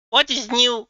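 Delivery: ordinary delivery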